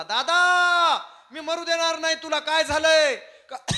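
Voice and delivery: very high, loud cry to an elder brother; acted full-force alarm; anguished shouting. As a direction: call out desperately while rushing to save him. Brother rushes up crying out.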